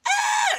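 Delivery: high, loud mock scream from next door; mock sex scream. Neighbours heard through the wall, mocked with a loud high scream. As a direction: belt out a hammed-up cry of passion. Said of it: "you would just hear".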